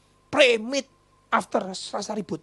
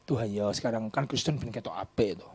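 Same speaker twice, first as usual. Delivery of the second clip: ordinary delivery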